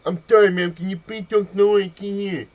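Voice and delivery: loud, high, garbled mock-deaf voice; faked deaf speaker. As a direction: exaggerate slurred consonants as if unable to hear yourself. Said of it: "I'm deaf, not stupid".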